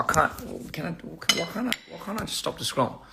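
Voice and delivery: quiet. Retold or imitated. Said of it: "somebody says".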